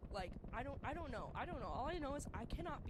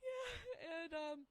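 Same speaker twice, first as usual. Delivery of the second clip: laughing; 8 semitones higher, much slower than usual. Real, not acted. Laughing hard at the spider memory.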